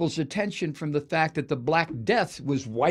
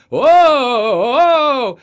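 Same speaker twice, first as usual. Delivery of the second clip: mock wail; 9 semitones higher, 12 dB louder than usual. Mock priests lamenting plague.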